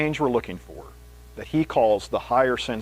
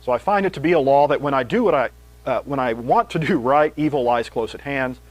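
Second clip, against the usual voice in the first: raised, louder reading of Paul; scripture reading; 5 dB louder than usual. Acted. Preacher reads Romans to the congregation, fervent. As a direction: read the passage out with fervour.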